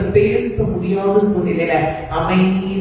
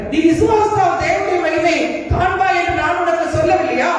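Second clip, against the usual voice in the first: high-pitched, insistent scriptural challenge; raised, declamatory questioning; challenging Lord; 10 semitones higher than usual. Acted. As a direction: press the question home like a preacher voicing the Lord. Acting the Lord challenging Martha.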